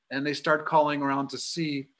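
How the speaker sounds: ordinary talk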